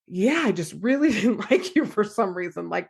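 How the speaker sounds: imitating a client talking through laughs; retold confession broken by light laughter; laughing. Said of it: "starts laughing and said".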